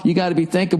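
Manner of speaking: ordinary talk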